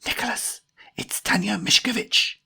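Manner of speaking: high, whispery hiss; urgent hiss. A narrator's reading. Jemima warns Nicholas who has walked in, urgent high hiss. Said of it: "hissed Jemima".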